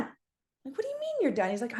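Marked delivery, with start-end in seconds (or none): 0.8-1.5 very high-pitched mock disbelief; incredulous. Friends questioning a player who says he is done, incredulous. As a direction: imitate stunned friends, voice leaping up.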